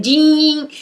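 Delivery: high, drawn-out ding; imitated alarm ding. Showing viewers a rude alarm wake-up, mock ding. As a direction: sing out a mock alarm ring.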